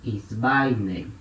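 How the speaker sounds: "Esbaine" articulado com tônica em "bai", tom grave; pronúncia grega modelo. Shown as a demonstration. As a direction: diga "Esbaine" como modelo para a turma. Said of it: "Então". (professor mostra a terceira pessoa "Esbaine", grave e pausado)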